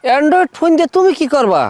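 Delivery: high-pitched, taken aback; startled question. Acted. Man asks partner why she wants a smartphone, startled. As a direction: pitch jumps up in disbelief.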